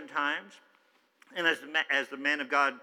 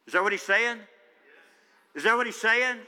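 preacher's challenge; 7 semitones higher, 6 dB louder than usual. Real, not acted. Asking congregation the question.

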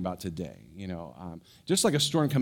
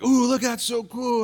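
put-on much higher, slow, thrilled shout of children; excited kids; 12 semitones higher, 5 dB louder than usual. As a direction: squeal like kids at a light show. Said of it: "All the kids are like".

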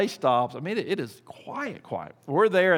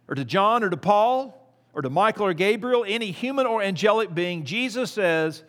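higher, louder sermon delivery; preaching at full force; 5 semitones higher, 4 dB louder than usual. Acted. Pastor preaching to his church, raised and emphatic.

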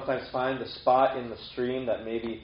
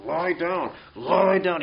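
mock order, raised and louder on the repeat; hammed-up bossy command, higher pitched; forceful command; 6 semitones higher, 6 dB louder, much slower than usual. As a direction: push a stubborn sheep down with your voice. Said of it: "He's not, like, forcing the sheep to lie down".

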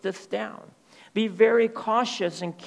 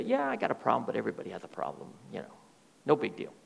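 dismissive shrug; 6 semitones lower, 6 dB quieter, much faster than usual. Retold or imitated. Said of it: "that's the attitude they have".

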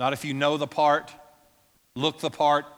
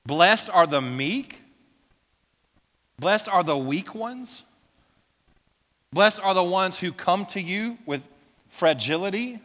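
scornful disbelief; 4 semitones higher, 4 dB louder than usual. Retold or imitated. Voicing the crowd's disbelief.

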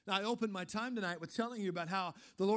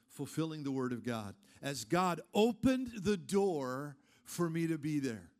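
ordinary delivery

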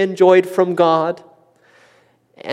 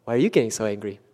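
quiet echo; 7 semitones lower, 7 dB quieter, much faster than usual. Shown as a demonstration. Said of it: "See the hypocrisy there?".

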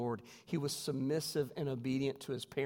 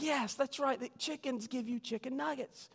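much higher, softening teacher voice; teacher praising; 11 semitones higher than usual. Retold or imitated. Teacher praising kids' answer, high sweet voice. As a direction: praise it like a patient schoolteacher. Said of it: "she was like".

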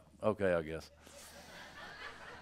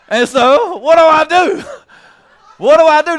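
laughing; 16 dB louder than usual. Real, not acted. Laughing helplessly at his own anecdote.

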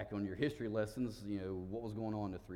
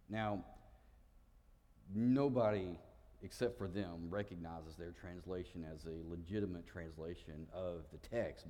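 normal talking voice

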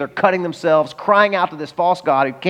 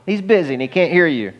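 mock taunt; 6 semitones higher than usual. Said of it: "You say".

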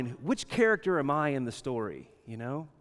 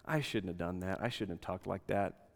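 lower, softer imagined remorse; quiet regret; 6 semitones lower, 9 dB quieter than usual. Retold or imitated. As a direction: admit it quietly, a touch of regret. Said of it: "to go".

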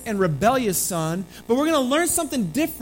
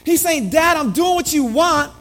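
higher and louder preaching; preacher exposes the dutiful son, louder; full-force preaching; 5 semitones higher, 7 dB louder than usual. Acted. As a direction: drive the point home from the pulpit.

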